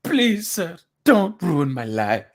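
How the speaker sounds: lightly acted plea for mercy; pleading impression. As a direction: plead like a desperate man, falling away. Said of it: "I love your impression of".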